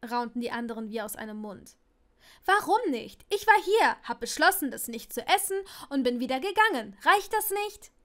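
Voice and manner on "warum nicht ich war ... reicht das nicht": indignant whine. Acted. Parvati beschwert sich aufgebracht.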